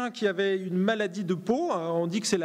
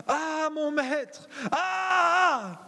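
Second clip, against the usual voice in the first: slow, high wailing cry of distress; anguished cry; 8 semitones higher, much slower than usual. Acted. Disciple wails to his master over the lost axe head. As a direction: cry out as if the tool is lost for good. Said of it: "Je le joue".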